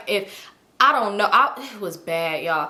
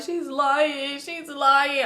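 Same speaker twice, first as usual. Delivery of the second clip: higher, drawn-out whimper; mock crying; 5 semitones higher, much slower than usual. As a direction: whine it out in tearful disbelief. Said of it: "So I'm crying".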